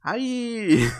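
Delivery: loud, high-pitched acted scream; high yell. Boy being flown up from the forest floor, high yell. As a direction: yell out as if snatched into the air. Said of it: "says Mike as he's being flown upward".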